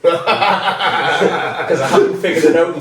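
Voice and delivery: a sarcastic joke dissolving into laughter; laughing. Real, not acted. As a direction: crack up while you say it. Sarcastic joke about himself.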